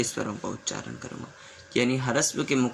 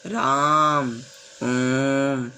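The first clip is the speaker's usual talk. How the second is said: louder, stretched राम and ओम; long shouted call; 5 dB louder, much slower than usual. Shown as a demonstration. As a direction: call the names across a distance. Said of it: "जब हम चिल्लाते हुए किसी को बुलाते हैं".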